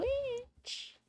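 high drawn-out call; witch summoning voice. Pretend. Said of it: "my witch summoning voice".

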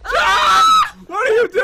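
very high, loud shriek of alarm; startled scream. Real, not acted. Player as partner triggers the TNT, startled shout. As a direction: scream and demand an explanation.

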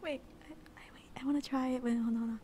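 ordinary talk, nothing marked

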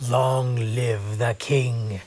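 low, slow, lion-king villain voice, lightly done; a deliberately bad villain lion impression, drawn out slowly; bad Jeremy Irons. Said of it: "that was a bad Jeremy Irons impression".

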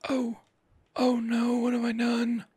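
a playful hammed-up oh no; mock dismay. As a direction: gasp at your own mistake in fake horror. Mock alarm at mistake.

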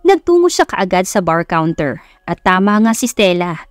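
plain reading voice

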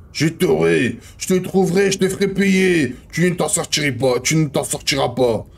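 vengeful figure in a story; threatening story character. Acted. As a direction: read the threats with dramatic insistence. Voices threats in the manuscript.

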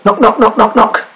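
high bright knocks; knocking sound. A narrator's reading. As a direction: tap out each knock lightly. Knocking at the door.

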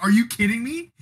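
high, loud indignant cry at the umpire; the protest peaking high and loud; reenacted outraged yell. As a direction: fling it up like you were robbed at home plate.

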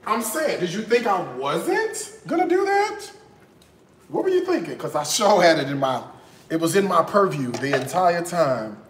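ordinary talk, nothing marked

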